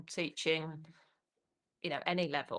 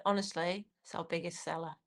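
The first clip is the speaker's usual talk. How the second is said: hushed, dropping towards a whisper; imitated salesperson's quiet aside; confiding retold reply; 2 semitones lower, 8 dB quieter than usual.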